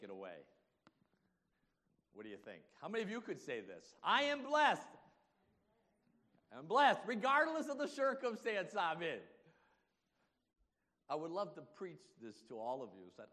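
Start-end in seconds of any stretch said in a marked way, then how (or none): 4.0-9.2 high, shouted testimony; loud, rousing profession of faith; emphatic declaration. Acted. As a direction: proclaim it at full force to the congregation. Models what congregation should declare.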